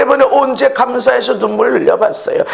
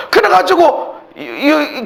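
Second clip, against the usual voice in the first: ordinary delivery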